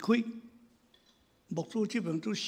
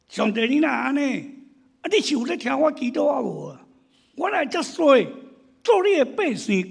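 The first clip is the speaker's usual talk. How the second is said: higher, louder aggrieved protest; complaining to God; 5 semitones higher, 4 dB louder than usual. Retold or imitated. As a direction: play a believer who feels cheated, grumbling loudly at God. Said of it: "找上帝". (大声对上帝埋怨)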